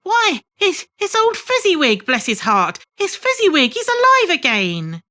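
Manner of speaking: put-on delighted shock at seeing an old friend; recognising old Fezziwig with joy; excited cry. A narrator's reading. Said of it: "Scrooge cried in excitement".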